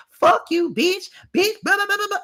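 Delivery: high mock rant, blah blah and all; mocking Britt's rant. Imitating Britt's tantrum.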